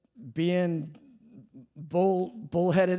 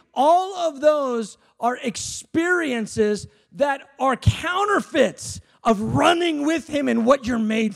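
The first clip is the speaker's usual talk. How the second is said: preaching shout; 9 semitones higher, slower than usual. Acted. Preacher shouting at the crowd that pleasures are counterfeits. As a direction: shout the point home.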